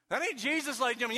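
ordinary talk

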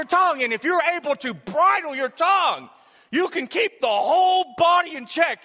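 high, loud, driving sermon delivery; full-force preaching. Real, not acted. Preacher telling the church to bridle the tongue, impassioned. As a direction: drive the point home to the congregation.

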